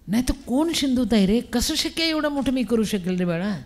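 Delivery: usual speaking voice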